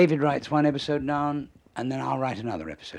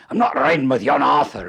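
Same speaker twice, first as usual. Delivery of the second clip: a hint of a Scots grumble, louder; lightly voiced cantankerous Scot refusing to share a car; Scottish grumble; 3 semitones higher, 10 dB louder than usual. John Laurie refusing to ride with Arthur, a light Scots grumble. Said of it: "And John Laurie says".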